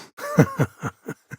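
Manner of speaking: sudden high burst of laughter. Real, not acted. Companion cracks up at the captain's antics, laughing. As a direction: break out laughing, delighted. Amused at something.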